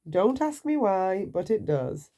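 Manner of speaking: ordinary delivery, nothing marked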